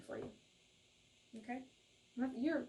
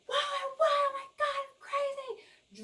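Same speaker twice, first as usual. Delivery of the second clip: much higher, squealing mock winner; mock lottery win; slower than usual. Reader mimics a lottery winner, mock squeal. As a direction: squeal like you just won the lottery. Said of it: "feeling like".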